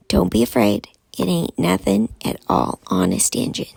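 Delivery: plain reading voice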